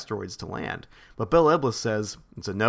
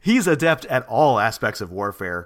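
laughing through line; 2 semitones lower, 5 dB louder than usual. A narrator's reading. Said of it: "Card agreed".

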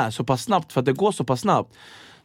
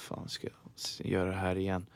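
whisper; 8 semitones lower, 14 dB quieter than usual. Retold or imitated. Dreading the tray again.

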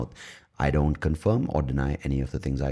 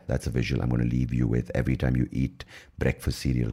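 ordinary delivery

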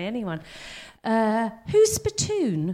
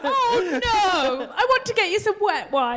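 higher mock-motherly fuss, laughing; 9 semitones higher, slower than usual. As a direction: play the doting mum, high and giggly. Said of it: "I feel so maternal over you".

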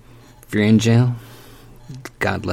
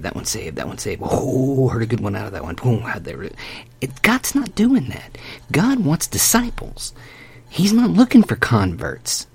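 fiery preaching; 2 semitones higher than usual. Acted. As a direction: preach it hard. Preaching at full force.

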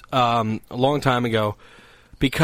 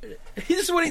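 ordinary talk

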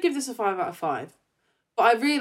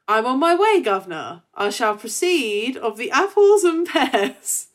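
higher, louder hammed-up Cockney, slow; mock Cockney; 3 semitones higher, 6 dB louder, slower than usual. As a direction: ham up an old Earth Cockney. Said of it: "old Earth Cockney".

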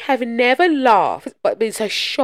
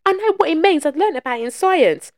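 put-on kid voice; 10 semitones higher, 4 dB louder than usual. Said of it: "He says".

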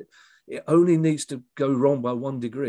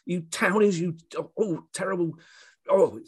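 much higher, louder mock tirade in an outraged voice; Dave Ricketts ranting; 8 semitones higher, 4 dB louder than usual. Peaceful friend ranting at badger-blamers in the pub, a higher, louder imitated outburst. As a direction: blow up like a furious badger lover whose table has just gone over. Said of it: "and he just went".

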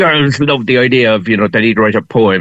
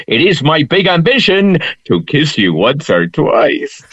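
higher, slow love-poem recitation; mock poem recital; 5 semitones higher than usual. Reciting a teenage love poem on request, playful recital. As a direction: recite it like a schoolboy's love verse. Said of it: "Give us one of the poems".

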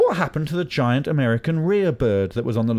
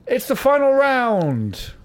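slow, high dramatic announcement; announcer voice; 13 semitones higher, 3 dB louder, much slower than usual. Acted. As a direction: build suspense like a game host.

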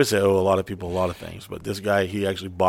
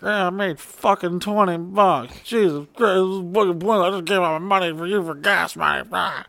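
high, whiny mock gripe; salty complaint; 9 semitones higher than usual. Roommate griping about his small tips, high whine. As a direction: whine it like a sore loser. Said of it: "He gets so salty".

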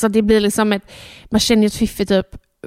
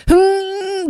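high pushing groan; straining grunt; 9 semitones higher than usual. Shown as a demonstration. Mother showing how she pushed before, high straining hum. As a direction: strain through a contraction. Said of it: "ta en krystvärk så här".

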